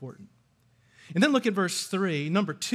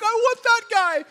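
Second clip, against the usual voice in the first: much higher and louder, mock swoon; hammed-up lovestruck lady squealing her want; swooning woman; 8 dB louder than usual. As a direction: play a smitten woman gushing over him. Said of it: "she's like".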